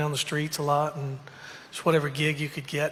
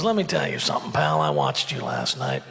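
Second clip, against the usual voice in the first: gruff dad critique; 2 semitones lower than usual. Retold or imitated. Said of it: "And he goes".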